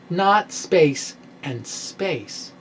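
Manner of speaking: slowed right down, leaning on not space and space; slow emphatic contrast. Real, not acted. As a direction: spell out the contrast word by word, slowly.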